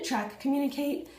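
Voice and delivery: normal talking voice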